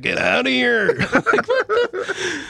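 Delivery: high squeaky laugh-through echo. Retold or imitated. Host laughs as he repeats the friend's impression. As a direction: squeal it while cracking up. Echoing friend's mock yell.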